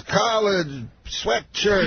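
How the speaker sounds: high, very slow impression, word by word; Bill Cosby. Comedian imitating the star's odd fragments, slow impression. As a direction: draw out each lone word up high. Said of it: "He talks, like, just in words".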